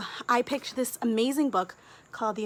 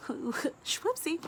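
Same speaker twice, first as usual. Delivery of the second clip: playful; 6 semitones higher, 4 dB quieter than usual. Real, not acted. After swearing on camera.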